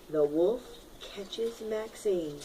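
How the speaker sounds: slow, soft and low; ominous hush. A narrator's reading. Teacher reads the scary turn slowly and hushed. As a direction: let it land slowly, hushed. Suspense in a read-aloud.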